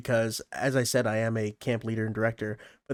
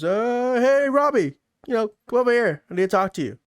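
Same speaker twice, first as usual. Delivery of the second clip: put-on a high, louder call across the pool to a kid; 11 semitones higher, 4 dB louder than usual. Camp leader calling Robbie over in a raised voice. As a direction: sing out his name to get his attention.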